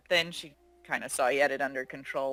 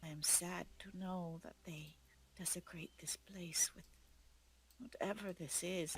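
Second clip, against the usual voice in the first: breathy, much softer whisper of grief; sad whisper; 3 semitones higher, 15 dB quieter than usual. Acted. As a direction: whisper as if at a grave. Mourning the desecrated ground.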